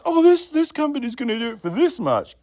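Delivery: high-pitched mimicry of a bargain hunter; mock naive customer. Vlogger imitating customers googling lowest price, mockingly naive. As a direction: put on a gullible customer voice.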